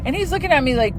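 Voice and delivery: normal talking voice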